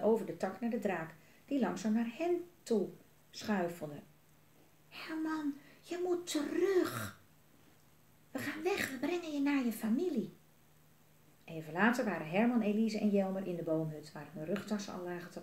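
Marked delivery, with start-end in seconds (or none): 4.9-10.3 put-on pleading boy voice. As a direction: coax the dragon back, urgently. Jelmer praat de draak toe. (Jelmer smeekt Herman hoog en dringend om terug te gaan)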